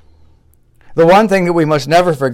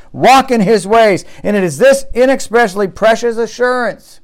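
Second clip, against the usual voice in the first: impassioned preaching; 6 semitones higher, 5 dB louder than usual. Real, not acted. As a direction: drive it home like a fervent preacher. Preaches at full force.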